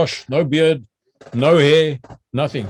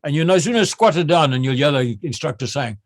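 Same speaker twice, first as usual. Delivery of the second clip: ordinary talk, nothing marked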